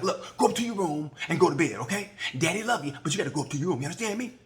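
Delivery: a loving but strict dad scolding; stern dad. Pretend. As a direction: send the kid to his room. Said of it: "I feel like a dad".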